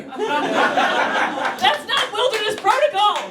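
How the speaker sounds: high, drawn-out mock protest; exasperated outburst. Comedian scolding own bear response, high exasperated outburst. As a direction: squeal the objection slowly.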